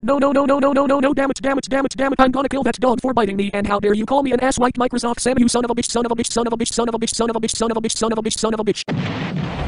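high fast furious ranting; tantrum. Acted. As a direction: throw a screaming tantrum. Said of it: "Tantrum in five".

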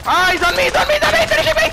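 high frantic yell; panicked yelling. Real, not acted. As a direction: scream for help as you go down. Teammate being finished by enemy.

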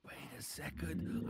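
hushed whisper, barely voiced; sneaky low whisper. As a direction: whisper so he won't hear. Sneaking up unnoticed.

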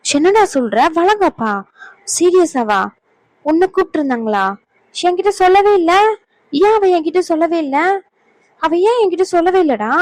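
high-pitched, stunned questioning; shocked disbelief. Acted. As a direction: repeat it in rising hurt astonishment. Learns she was never told.

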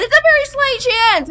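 excited fast. Real, not acted. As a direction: rush it out excitedly. Excited emphasis.

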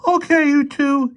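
slow, very high, loud yell. A narrator's reading. Armed boy halting two intruders, yelling. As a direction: yell it out as a mean teenage boy. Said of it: "he yelled".

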